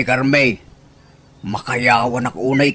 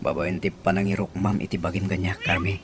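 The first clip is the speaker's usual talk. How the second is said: tender confession; 4 semitones lower, 8 dB quieter, faster than usual. Acted.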